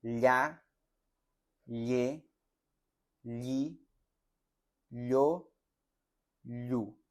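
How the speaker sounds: "Ya, ye, li, yo, lu" syllable by syllable; teacher's syllable practice; ll syllable drill. Shown as a demonstration. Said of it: "practiquen bien esta pronunciación".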